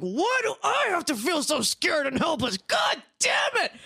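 re-enacted desperate shout, pitched high; imitating an exasperated yell that erupts from nowhere; sudden angry outburst. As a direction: cry out in frustration, building to a long, high curse. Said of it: "out of nowhere, as if it was someone else".

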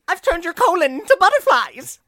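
high, very loud gleeful-villain shout; hammed-up shriek of a boast; Jamie impression. Imitating Jamie's absurd boasts, shouted high. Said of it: "that's the kind of thing Jamie does".

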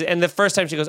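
ordinary delivery

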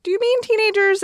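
a playful double-take at the listener's wording; surprised question. Retold or imitated. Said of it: "I was like".

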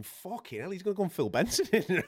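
a touch of alarm, as if thinking aloud; retold alarm. Presenter realising a fighter may start trouble, mildly alarmed.

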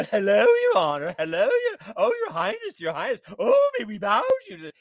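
high, loud sneering mock reverence. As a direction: fawn over the king to ridicule him. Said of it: "they're making fun of the king".

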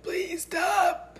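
high, strained mock plea; imitating a worn-out voice. Host mimicking her own lost voice, high strained squeak. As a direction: squeak it out like a voice giving up. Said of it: "my voice is like".

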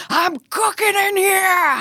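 high, drawn-out singsong holler; yelling out. Retold or imitated. Cook calling out to the neighbors, high playful yell. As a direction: call it out slowly across a courtyard. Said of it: "yelling out into my atrium".

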